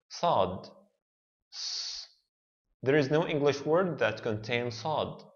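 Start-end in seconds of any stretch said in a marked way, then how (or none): 0.1-2.1 "Sad", then "s" alone as the heavy Arabic emphatic s. Shown as a demonstration. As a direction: demonstrate "Sad" with an emphatic, backed s. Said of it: "it's an emphatic s".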